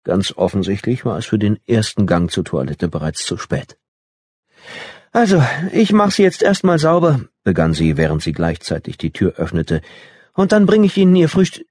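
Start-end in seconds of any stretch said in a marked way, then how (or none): 5.1-11.7 high, cheery caretaker chatter; bright nurse voice. A narrator's reading. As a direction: chirp it brightly while entering. Nurse cheerfully entering room.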